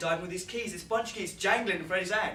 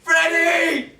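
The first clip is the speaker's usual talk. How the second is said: loud, high calling out; shouting; 11 semitones higher, 15 dB louder, much slower than usual. Acted. Driver shouting for Freddy after the crash, loud and high. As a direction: call out for your friend. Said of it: "this boy's voice shouting back".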